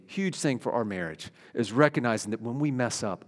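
ordinary talk, nothing marked

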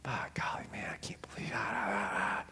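whispered mock grumbling groans; Fred Flintstone grumble. Preacher mimics a grumbling Israelite in a hushed mock groan. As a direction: grumble under your breath like a cartoon caveman. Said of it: "Sound like Fred Flintstone".

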